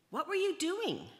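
loud, high-pitched indignant question; demanding. A narrator's reading. Nine Leos confronting Leo after his nap, demanding loudly. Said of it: "they demanded".

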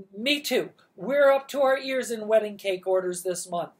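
hasty. Acted. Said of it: "Missus Baker said hastily".

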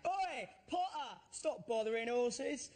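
high, slow, mocking yell; swaggering Londoner's shout. Swaggering Londoner shouting at the star outside the stage door. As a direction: heckle like a cocky Londoner. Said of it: "shouted out really loudly".

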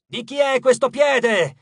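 slow, high, loud demand; exasperated goddess shouting. A narrator's reading. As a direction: demand it at the top of your voice. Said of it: "gridò di nuovo Nyx".

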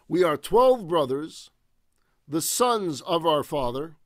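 building storyteller's intensity; dramatic scripture reading. Acted. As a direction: proclaim the verses like a preacher. Forceful reading of Joseph's brothers' report.

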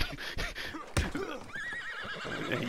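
falsetto giggle that trails off; drawn-out wheezing laugh; long high laugh. Real, not acted. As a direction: laugh at your own joke. Laughing at his own threat.

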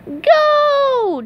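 very high, drawn-out cheer; SpongeBob cheering. A narrator's reading. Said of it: "cheered SpongeBob".

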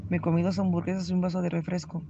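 usual speaking voice

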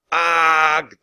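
big "arg" of a pretend monster; monster shout. A narrator's reading. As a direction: roar to scare them off. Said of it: "they shouted".

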